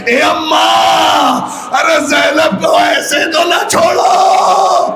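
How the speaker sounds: cling and cry out in anguish. Acted. Zainab clinging to her mother's breast, wailing. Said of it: "زینب تڑپ کے".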